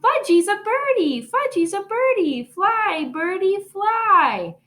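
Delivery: put-on high, gleeful little boy voice; excited toddler. A narrator's reading. Fudge flapping his arms on the jungle gym, gleeful. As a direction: delight in playing bird.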